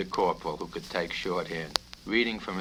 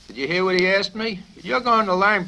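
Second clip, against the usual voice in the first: much higher, louder outburst; outraged colonel; 12 semitones higher, 9 dB louder than usual. Acted. Colonel turning on Popinjay, outraged. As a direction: explode at the question.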